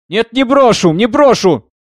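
high, loud, stubborn outburst; defiant refusal. A narrator's reading. Singer refuses to quiet down. (упрямый громкий протест)

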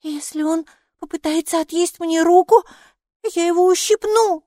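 put-on high, loud, determined child voice; resolute child. A narrator's reading. Майки threatens to pinch it, high resolute child voice. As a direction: declare it firmly in a high child's voice. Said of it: "решительно сказала Майки".

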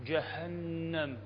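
جهنم very slow and soft, breath on ه; drawn-out جهنم from deep in the belly; airy Ha. Shown as a demonstration. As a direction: exhale through the ه of جهنم, hold the ن. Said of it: "فتلاحظ الهاء".

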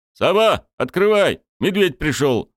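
loud high shout. A narrator's reading. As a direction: yell it out at full voice. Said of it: "с криком".

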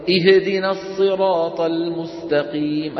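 slow chant with اهدنا as "ihe-dina"; recite, shaking the h of اهدنا into an extra vowel; wrongly bounced haa. Retold or imitated. Teacher imitates what some people recite, chanted with bouncing haa. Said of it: "يجعلها تهتز".

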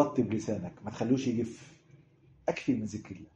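softly reassuring counsel; gentle advice. Retold or imitated. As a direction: comfort him quietly. Prophet's gentle advice voiced.

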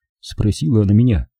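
ordinary delivery

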